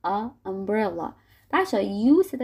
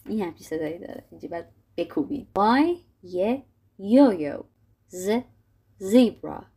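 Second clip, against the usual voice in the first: ordinary delivery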